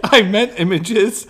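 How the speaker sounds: slow, high laughing correction. Real, not acted.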